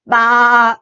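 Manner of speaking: loud, drawn-out baa; playful sheep imitation; sheep bleat. A narrator's reading. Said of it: "said the sheep".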